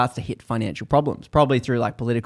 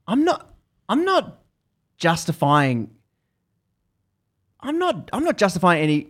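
fuming, sputtering; 8 semitones higher than usual. Retold or imitated. Abby sputtering back at the calm officer, indignant. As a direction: choke on the words in outrage.

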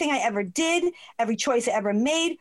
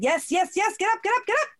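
lightly acted urging, rising higher; quick-fire repeated commands, pitched up; insistent inner voice; 9 semitones higher than usual. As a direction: imitate a voice pressing someone to move. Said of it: "that male voice".

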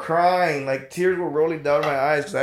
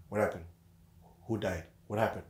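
retold hushed questions; 6 semitones lower, 10 dB quieter, faster than usual. Friend's questions after eavesdropping.

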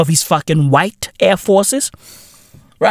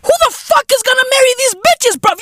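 jumps way high and fast in disbelief; high exasperated burst; faster than usual. Real, not acted. Asking who marries them, high fast disbelief. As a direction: fire it off high and quick.

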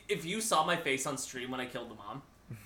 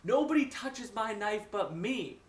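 slow, raised villain voice; menacing knife owner; 6 semitones higher, slower than usual. Retold or imitated. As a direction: stretch it out as a possessive villain.

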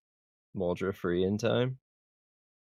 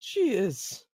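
tearful; 12 semitones higher, 3 dB louder than usual. Acted. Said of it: "he's tearing up".

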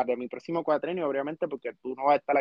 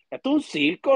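exclaimed loud; 4 semitones higher, 4 dB louder than usual. Real, not acted. Outburst about the federal "circus.

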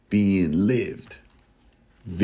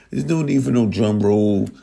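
ordinary talk

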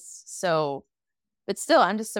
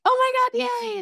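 much higher, louder squeal of joy; crowd cheering; 3 dB louder than usual. Retold or imitated. Villagers cheering Po's return, high excited squeal. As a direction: squeal it like a crowd seeing its hero.

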